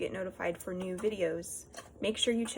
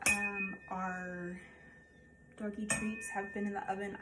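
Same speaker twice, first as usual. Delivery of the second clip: normal talking voice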